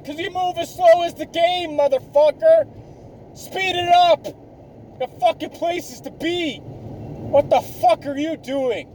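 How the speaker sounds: high-pitched, exasperated telling-off; retold angry rant. Ranting at the slow truck driver at the gas station, angry and high. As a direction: scold a dawdler in a high, worked-up voice. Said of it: "I'm fucking mad at this point".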